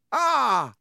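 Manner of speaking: high, loud cry of shock; startled cry. Acted. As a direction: yelp as the door dents. Branch punches the car door.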